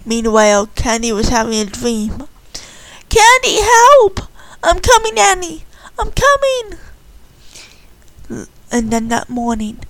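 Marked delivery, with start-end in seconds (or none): 3.1-6.8 high frantic call; cry for help. Acted. Annie calling Candy for help in a dream, high and frantic. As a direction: call out in panic.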